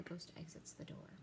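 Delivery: whispered, the ghost slipping out; hushed whisper. Acted. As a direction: drop to a secretive whisper. Spooky aside after the outburst.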